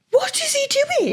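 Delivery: high, disbelieving question; incredulous. Real, not acted. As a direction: ask it in disbelief. Incredulous at absent father.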